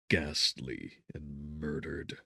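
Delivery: ordinary delivery, nothing marked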